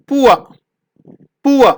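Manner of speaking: പൂവ said as poo-uh with an uh glide; modelled pronunciation. Shown as a demonstration. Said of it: "ഉവ എന്നുള്ള ശബ്ദം വരുന്നു". (പൂവ ഉച്ചാരണം)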